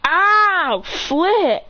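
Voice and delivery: high startled cry; acted yelp. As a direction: yelp it in mock alarm. Acted cry while floating.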